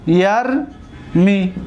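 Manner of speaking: یرمی stretched out very slowly; very slow yarmi. Shown as a demonstration. Teacher telling students to look, then saying یرمی very slowly. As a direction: say یرمی slowly so each sound is heard. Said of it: "ببینید".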